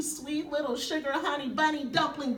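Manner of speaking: ordinary talk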